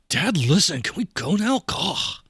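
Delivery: put-on whiny teen pestering Dad; exasperated teenager. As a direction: groan it like a fed-up kid. Sullen teenager whining to dad.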